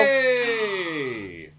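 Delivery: loud excited cheer; triumphant shout. Real, not acted. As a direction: cheer the win loudly. Wins a point.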